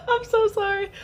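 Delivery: high, squeezed by real laughter; laughing apology. Real, not acted. Mother sorry for calling newborn ugly, laughing apology. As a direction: apologise while recovering from a laughing fit.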